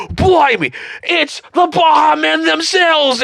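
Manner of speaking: high, stretched-out Aussie exclamation; hammed-up Australian host's amazed announcement; Australian accent, yelled. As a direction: shout it in a broad Aussie voice. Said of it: "You found an accent you're good at".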